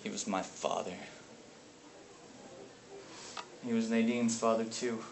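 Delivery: low, very quiet and halting; grieving. Acted. Brother facing his unbearable past, quiet grieving. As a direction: let each admission out softly and low. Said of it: "grieving over an unbearable past".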